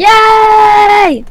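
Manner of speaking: a high, loud little cheer; excited cheer. Pretend. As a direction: cheer like a newborn robot. Cheering its own existence.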